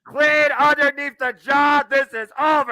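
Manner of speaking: excited call. Real, not acted. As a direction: land the final call. Calling the finish.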